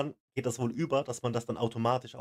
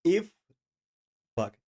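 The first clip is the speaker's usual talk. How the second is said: ordinary talk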